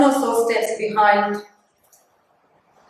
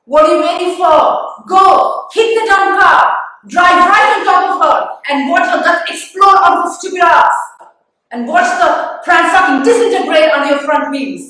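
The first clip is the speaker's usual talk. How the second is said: loud, raised, vicious rant; furious urging; 4 semitones higher, 6 dB louder than usual. Acted. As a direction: egg the driver on with mounting fury. Silently urging driver to run her over.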